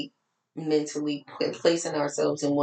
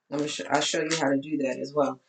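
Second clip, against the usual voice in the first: ordinary talk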